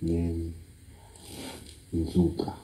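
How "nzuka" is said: ordinary talk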